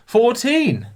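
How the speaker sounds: high, loud Fourteen; triumphant. Real, not acted. Celebrating the right count, high and loud. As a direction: shout it in triumph. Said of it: "You learn something new".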